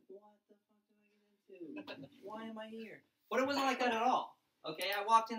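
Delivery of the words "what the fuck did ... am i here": much softer, lost mumble; bewildered kids. Retold or imitated. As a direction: sound like a confused kid on the couch. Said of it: "everybody's just like".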